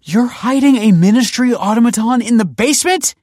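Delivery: very high, loud disbelief; shocked outburst. A narrator's reading. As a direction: shout the question, appalled. Shocked at the discovered automaton.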